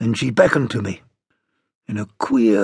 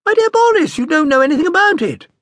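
much higher, airy dismissive mother; mother waving off Boris, high and airy; 7 dB louder, faster than usual. A narrator's reading. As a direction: brush the husband off grandly.

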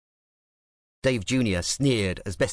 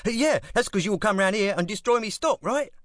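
much higher East London retort; 12 semitones higher than usual. A narrator's reading. Club boss retorting to gangster, East London jab.

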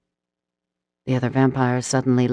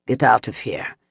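stern command; 3 semitones lower than usual. Acted. Reichin orders terrified human out.

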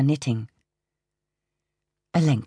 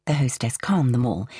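usual speaking voice

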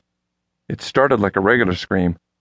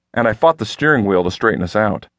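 plain reading voice

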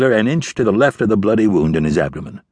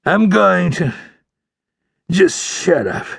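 high, strained, irritated retort; irritated dying outlaw; 11 semitones higher, slower than usual. A narrator's reading. Dying outlaw fed up with the ranger's question, irritated. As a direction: fend him off through the pain.

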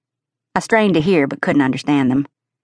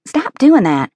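high, loud irritated snap; 10 semitones higher, 4 dB louder than usual. A narrator's reading. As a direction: snap it at him, fed up. Irritated narrator snaps at coach.

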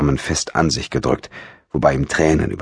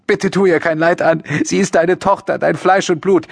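high, urgent mother's plea; pleading call; 14 semitones higher than usual. A narrator's reading. Mother begging father to spare their daughter, high desperate pleading. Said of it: "hörte Maria ihre Mutter rufen".

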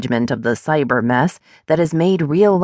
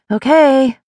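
louder, much higher call of agreement; 9 semitones higher, 5 dB louder than usual. A narrator's reading. Woman agreeing to a lift from friend, calling out. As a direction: call "Okay" across to the other room. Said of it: "I call out".

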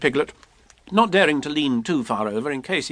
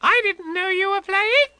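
put-on very high little child's voice; squeaky young creature; Roo. A narrator's reading. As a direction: pipe it up like a tiny youngster. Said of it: "said Roo".

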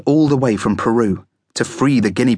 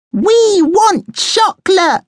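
very high, loud, drawn-out demand; squeaky, stretched-out yell; children yelling; 6 dB louder, much slower than usual. A narrator's reading. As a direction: yell it slowly like clamouring kids. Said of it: "they yelled".

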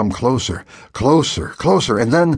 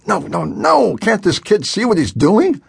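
much higher, dismayed outburst; alarmed despair; 10 semitones higher than usual. A narrator's reading. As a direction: cry out watching the dive. Pilot despairing.

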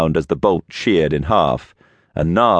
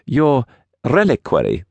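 put-on halting, higher Russian-accented voice; 7 semitones higher than usual. A narrator's reading. Russian visitor searches for the right word, strong Russian accent. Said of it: "struggled to find the right word".

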